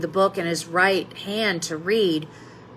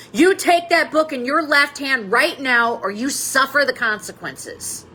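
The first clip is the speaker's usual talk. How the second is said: mock shout, higher-pitched, easing at the end; angry schoolmaster's shout; 6 semitones higher than usual. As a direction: order the boy sternly, voice raised in pitch. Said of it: "He shouted at the boy".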